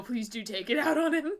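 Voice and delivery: ordinary talk, nothing marked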